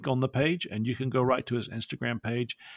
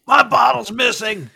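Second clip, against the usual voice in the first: high, loud mock falsetto holler; hammed-up shrill complaint; yelling wife; 13 semitones higher, 10 dB louder than usual. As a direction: screech it like an outraged wife. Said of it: "Joey's wife was yelling. I think I heard her say".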